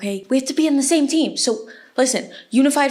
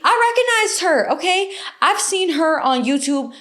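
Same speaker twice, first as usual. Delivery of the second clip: high excited burst; 7 semitones higher than usual. Real, not acted. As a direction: leap up in pitch, thrilled. Excited realisation.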